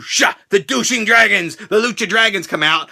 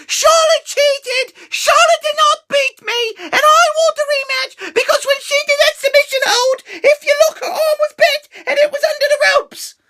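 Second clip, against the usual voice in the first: whiny Paige. Retold or imitated. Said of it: "and Paige is like".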